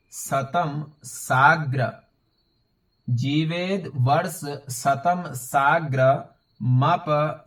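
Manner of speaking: drawn-out, measured word-by-word Sanskrit reading; slow teaching recitation. Shown as a demonstration. Teacher shows learners how to read the forty-seventh shlok, slow recitation. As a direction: recite slowly, syllable by syllable.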